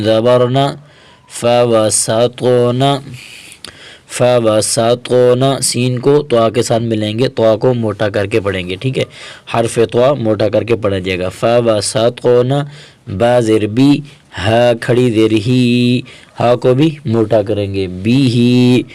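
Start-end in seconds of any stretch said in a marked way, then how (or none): none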